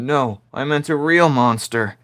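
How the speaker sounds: mock monster kid. Quoting a kid wanting a monster.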